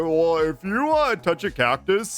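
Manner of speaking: Slurp voice. Retold or imitated. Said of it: "stimming in Slurp voice".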